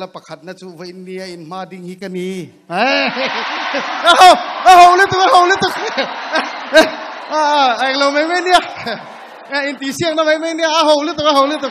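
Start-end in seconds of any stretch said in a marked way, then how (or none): 2.7-11.7 high, loud laughing cheer; laughing shout. Real, not acted.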